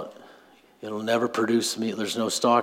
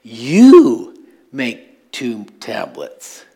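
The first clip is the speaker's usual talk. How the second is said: God's stern command; 3 semitones higher, 13 dB louder than usual. Retold or imitated. God telling Moses to make the new tablets, loud stern command. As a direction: lay down the law, making Moses do it himself. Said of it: "what Moses have to do after this".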